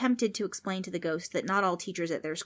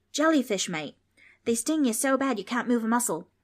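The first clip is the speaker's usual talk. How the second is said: put-on Australian boy; 5 semitones higher, 5 dB louder than usual. Darby explains, Aussie.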